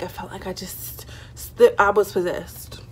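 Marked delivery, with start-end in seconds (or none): 0.0-1.1 whispering. Real, not acted. Whispered aside.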